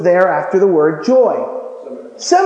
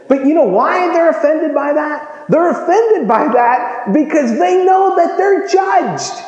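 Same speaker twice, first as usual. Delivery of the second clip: preaching shout; 8 semitones higher, slower than usual. Real, not acted. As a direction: hammer the point home. Preacher's high loud point.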